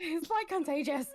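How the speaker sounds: high-pitched words through laughter; laughing. Real, not acted. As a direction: giggle it out helplessly. Players laughing over contagious accent.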